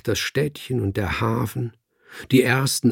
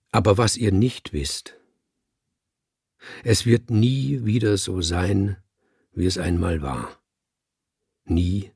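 sonorous speech. Acted. The speaker addresses the cats, sonorous and solemn. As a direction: hold the voice full and sonorous, like an orator. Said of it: "hielt meine Stimme sonor".

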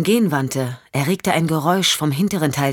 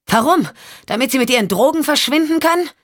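much higher, fast and sharp; Michelle, furious; 9 semitones higher than usual. Acted. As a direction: fire the accusation back quickly. Michelle snaps back in anger.

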